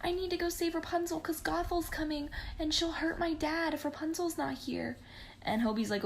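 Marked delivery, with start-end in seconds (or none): 0.0-5.0 much higher, urgent and worried; panicked Penelope. Retold or imitated. Penelope tells Hobie she must save Rapunzel, higher and worried. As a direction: rush it out, worried for dad.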